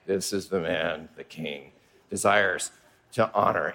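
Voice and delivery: humiliated proclamation. Acted. Haman leads Mordecai through the street, low and reluctant. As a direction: drag the words out like it pains you.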